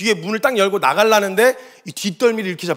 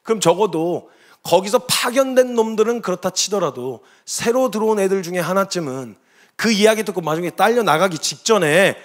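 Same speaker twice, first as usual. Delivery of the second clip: ordinary delivery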